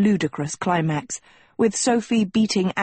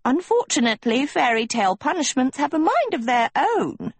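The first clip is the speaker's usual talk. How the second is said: high, swooping gnome voice; gnome calling back; 6 semitones higher than usual. A narrator's reading. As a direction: call back airily in a piping gnome voice. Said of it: "the gnome called back".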